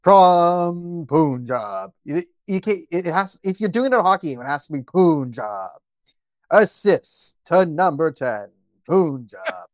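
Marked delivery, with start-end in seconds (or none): 0.0-1.9 booming, drawn-out arena announcer; hockey arena announcer. Pretend. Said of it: "hockey arena voice". 4.8-9.7 slow booming announcer call; hockey arena announcer. Pretend. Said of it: "hockey arena voice".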